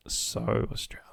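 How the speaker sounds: so Australian, whispered almost under the breath. Real, not acted. Vlogger whispering so Australian to viewers about handball. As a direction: whisper so Australian as a fond aside. Repeated phrase, whispered take.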